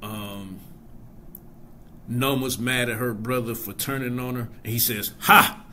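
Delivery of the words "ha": imitating a Nigerian man's sharp "Ha!"; a mock Nigerian "Ha!", loud and high; a short scoffing Nigerian "Ha!" louder and higher. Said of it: "just so Nigerian the way he said".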